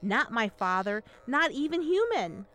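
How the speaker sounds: put-on high, urgent girl's plea; desperate pleading. A narrator's reading. Yer begs mother to see through disguise, pleading. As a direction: plead high with a mother who will not believe.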